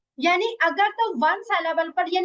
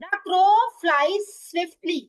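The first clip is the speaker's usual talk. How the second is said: even slower repeat, words spaced out; slow dictation; much slower than usual. Shown as a demonstration. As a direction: dictate it once more, word by word. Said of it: "teacher repeats dictation". (बहुत धीमे दोहराकर लिखवाना)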